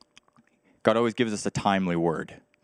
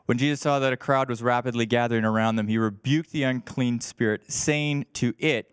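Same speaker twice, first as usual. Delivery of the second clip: usual speaking voice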